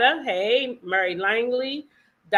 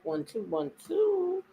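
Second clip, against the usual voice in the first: mic check; 8 semitones higher, 6 dB quieter, slower than usual. Pretend. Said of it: "I see that mic check".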